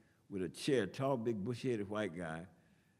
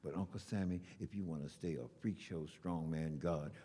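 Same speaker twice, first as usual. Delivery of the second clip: poem recital; 4 semitones lower than usual. Acted. Poet refuses Uncle Sammy's war, reciting defiantly. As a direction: lower aside growing into firm defiance. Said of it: "So I went on to recite this poem".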